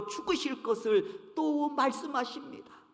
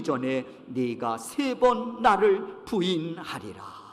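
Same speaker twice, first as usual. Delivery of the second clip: measured unison scripture reading; slow congregational reading; 4 semitones lower, 7 dB louder than usual. Shown as a demonstration. Pastor leads the congregation reading the verse slowly. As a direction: read aloud slowly and evenly with the church. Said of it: "다 같이 한번 읽도록 하겠습니다".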